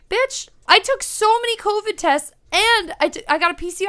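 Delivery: exasperated. Retold or imitated. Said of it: "And I'm like".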